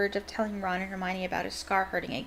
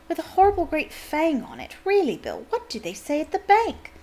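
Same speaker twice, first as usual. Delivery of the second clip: a mild, lightly voiced fussing mother, higher; gently scolding mother voice, higher; fussing mum; 8 semitones higher, 3 dB louder than usual. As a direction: tut at your grown son like a mum. Mother scolding son about earring.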